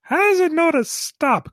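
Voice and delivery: high, loud dim-witted robot; puzzled robot voice. Acted. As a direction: wonder aloud like a clueless sidekick. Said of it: "Cubot's just looking at the beam saber going".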